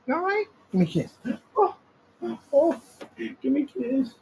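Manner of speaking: high cooing pet voice. Real, not acted. Owner asking his cat for a kiss, high cooing.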